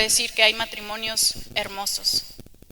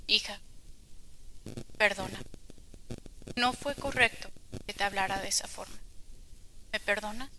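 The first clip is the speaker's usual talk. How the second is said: tender apology; 9 dB quieter than usual. Retold or imitated. Mother apologizing to little daughter.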